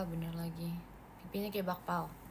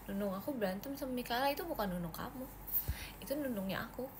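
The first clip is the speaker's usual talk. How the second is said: whispered aside, softer; 6 dB quieter than usual. Real, not acted. To viewers, whispering a secret aside.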